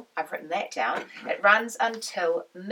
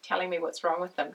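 ordinary talk

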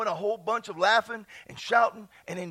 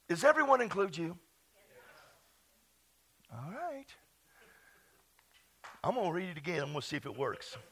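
ordinary delivery

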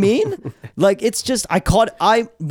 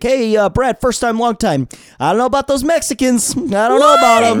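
higher, louder crank caller voice; angry caller impression; 10 semitones higher, 4 dB louder than usual. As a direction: play a bigoted talk radio caller. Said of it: "the ones that were calling in".

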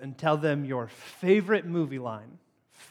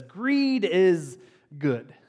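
"Greed is good." with each word drawn out; a hint of Gordon Gekko; very slow "Greed is good."; much slower than usual. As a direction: savor each word like a tycoon. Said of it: "Gordon Gekko says".

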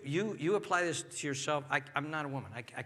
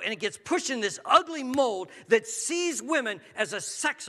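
loud, high, driving sermon delivery; preaching at full force; 6 semitones higher, 6 dB louder than usual. Real, not acted. Preacher warning the men in the congregation, loud and rising. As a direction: build it up and hammer the point.

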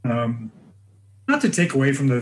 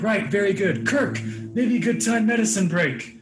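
higher, slower, a hint of an old wrestler's accent; lightly voiced old wrestler, keen to move the line along; old timer brushing off; 7 semitones higher, slower than usual. Telling how the Iron Sheik waved off a fan, lightly mimicked and slower. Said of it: "He's like".

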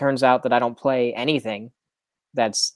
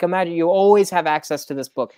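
normal talking voice

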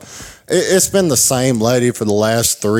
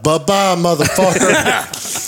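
high, drawn-out mocking goodbye; taunting send-off; 6 semitones higher, slower than usual. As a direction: wave them off in a teasing, raised pitch. Taunts seated stranger after standing up.

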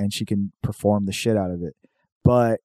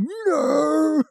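very high, drawn-out sneer; hammed-up football bully jeering at a nerd; sneering jock. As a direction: drag the word out with contempt.

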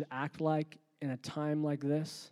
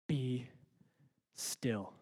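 calm command; 2 semitones lower, 5 dB quieter than usual. Acted. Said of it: "in a very calm, direct manner".

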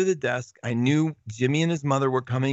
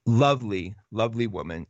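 ordinary talk

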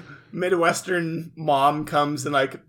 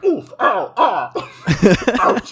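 pained yelps; 6 semitones higher, 7 dB louder than usual. Acted. Voicing Yugi tumbling in bag.

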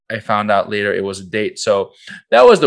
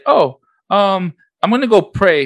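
put-on high girl voice; 11 semitones higher, 6 dB louder than usual. Imitating the girl asking to pray.

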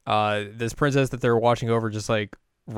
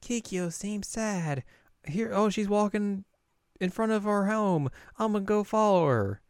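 put-on small kid voice; 10 semitones higher, slower than usual. Kid can't sleep and worries about Kikyo, high small voice. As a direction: talk like a sleepy little kid. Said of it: "and this kid's like".

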